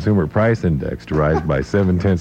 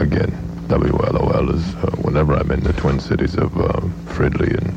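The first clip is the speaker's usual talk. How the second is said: deadpan accented impression, pitched down in a monotone; Henry Kissinger. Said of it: "Henry Kissinger is with us".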